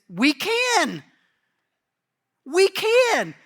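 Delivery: high, loud eager "we can"; eager boast. Retold or imitated. Disciples assuring Jesus they can, high and loud.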